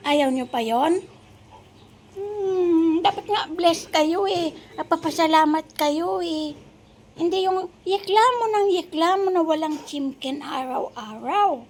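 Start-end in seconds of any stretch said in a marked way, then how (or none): 2.1-11.7 higher-pitched cutesy pet-talk scolding. Real, not acted. Baby-talk scolding to the dogs.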